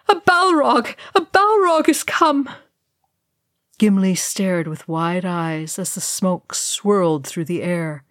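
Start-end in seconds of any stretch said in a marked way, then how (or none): 0.1-2.5 high, loud wail of terror. A narrator's reading. Elf warning companions of the monster, wailing. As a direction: cry the warning out in panic. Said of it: "Legolas wailed".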